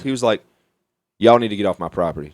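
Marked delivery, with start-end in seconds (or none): 1.2-2.3 low, even, unbothered warning; a low, calm warning to the trespassers. Retold or imitated. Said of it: "just like that".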